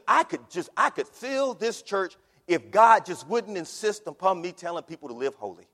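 normal talking voice

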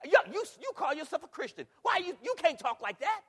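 loud, high-pitched indignant challenge; acted accusation. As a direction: call them out like a scandalized onlooker.

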